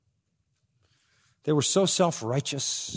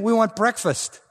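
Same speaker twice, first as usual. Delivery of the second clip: mocking paraphrase; 9 semitones higher, 4 dB louder than usual. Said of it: "what they were really saying".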